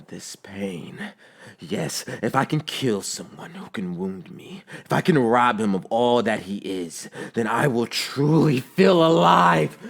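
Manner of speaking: hushed menace building to a frenzied cry; deranged, building. A narrator's reading. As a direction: start near a whisper, climb to a drawn-out yell. Villain works himself into frenzy.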